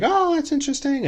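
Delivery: high, fake-polite small talk. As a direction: feign interest, sing-song high. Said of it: "being polite".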